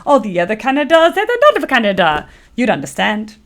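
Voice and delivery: high, loud mock French Canadian singsong; hammed-up French Canadian accent, easing down at the end. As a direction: belt it like a cartoon French Canadian. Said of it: "singing their little song".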